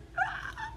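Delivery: very high, soft squeal; tiny helpless squeak of glee; squealing laugh. Real, not acted. Fan thrilled by group card, high squealing laugh.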